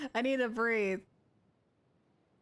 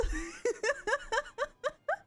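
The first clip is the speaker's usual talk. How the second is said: high-pitched giggling fit; delighted laugh; 10 semitones higher than usual. Real, not acted. Streamer thrilled to own buttons, delighted laugh. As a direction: giggle high with sheer glee.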